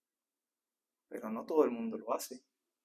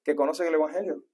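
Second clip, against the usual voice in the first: fiery preaching; 9 dB louder, faster than usual. Acted. Preaching at full force.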